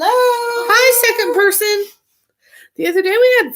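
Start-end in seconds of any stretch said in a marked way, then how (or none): 0.0-1.9 high, loud cheery hello; excited greeting. Real, not acted. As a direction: greet the newcomer with delight. Greeting a new viewer.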